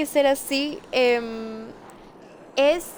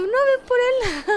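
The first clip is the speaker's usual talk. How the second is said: mocking boyfriend; 9 semitones higher, 4 dB louder than usual. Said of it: "Y tú".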